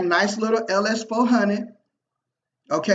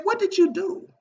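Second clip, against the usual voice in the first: perplexed; 10 semitones higher than usual. Retold or imitated. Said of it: "he had this perplexed look on his face".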